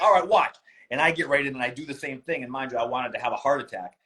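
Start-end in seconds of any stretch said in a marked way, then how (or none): 0.0-0.6 high, loud, pumped; psyched up. Retold or imitated. Bracing himself for the cold plunge, loud and high. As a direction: hype yourself up before jumping in. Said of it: "I was like".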